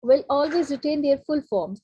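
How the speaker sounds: ordinary talk, nothing marked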